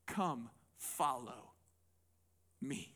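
low, slow, soft invitation; a calm, low, soft call to come along; Jesus calling softly. Retold or imitated. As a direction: gently call him to follow, drawn out and hushed. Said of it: "this is the guy that Jesus says".

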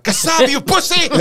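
high, loud drill-sergeant shout; loud barked order, acted out; angry sergeant yell. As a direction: yell abuse at a straggling recruit. Sergeant yelling, acted in story.

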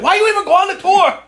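high, loud, angry shout; mad yell. Retold or imitated. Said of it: "And he's like".

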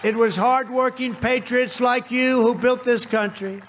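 ordinary talk, nothing marked

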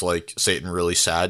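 normal talking voice

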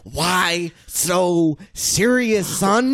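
Heath Ledger Joker. Acted. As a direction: laugh and cry through the line like a mad clown. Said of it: "I am Heath Ledger".

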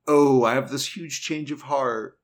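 hammed-up fake confession; mock change of heart. Mock quote of Nicki's conversion.

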